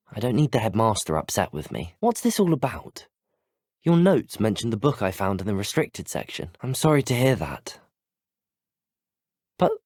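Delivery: ordinary talk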